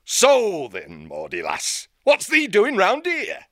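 a northern-voiced old owl hermit, gruff and folksy. Old hermit owl hailing the young hare. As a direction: greet her like a crusty old country owl.

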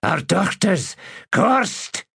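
put-on a frightened innkeeper in a South Islander accent. A narrator's reading. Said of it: "the accent South Islander".

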